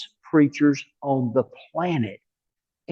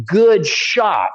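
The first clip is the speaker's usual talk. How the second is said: mock sarcastic holler, higher and louder; retold yell; 5 semitones higher, 5 dB louder than usual. Backyard owner to the golfer who hit his yard, mock yell. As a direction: imitate an angry yell across a backyard. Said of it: "he yells out at the top of his lungs".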